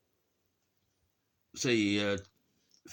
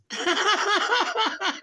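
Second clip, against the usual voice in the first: shrieking high giggle; high laugh; 9 dB louder than usual. Real, not acted. Cracking up over the British joke. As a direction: let out a squealing laugh.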